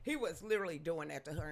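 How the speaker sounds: usual speaking voice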